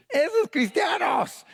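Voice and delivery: very high, drawn-out taunt; mocking shout. Preacher voices onlookers calling out believers, shrieked mocking taunt. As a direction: cry out the nickname as a mock jeer.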